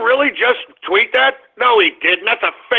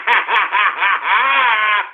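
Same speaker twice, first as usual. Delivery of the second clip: gloating cackle. Real, not acted. Host after the victory cry, gloating laughter. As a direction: cackle on and on in triumph.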